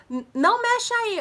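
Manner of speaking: high, loud, stretched-out warning; teacher's alarmed command. Retold or imitated. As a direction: shout at a child to stop touching. Acts teacher shouting at child. (grito de alerta da professora, agudo)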